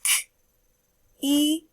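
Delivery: "K, E" as two separate sounds; sounding out words. Shown as a demonstration. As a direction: segment "K, E" sound by sound with pauses. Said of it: "One more time".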